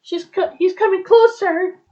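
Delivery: high-pitched alarmed warning; startled. Acted. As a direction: warn as a startled quail. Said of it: "Quail is really startled".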